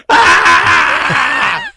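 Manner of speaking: long, loud mock scream; imitated fan scream. Host acting out a Leafs fan's horror, loud mock scream. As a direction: scream like a stunned fan with head in hands. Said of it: "he's just screaming".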